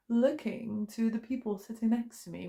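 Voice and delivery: normal talking voice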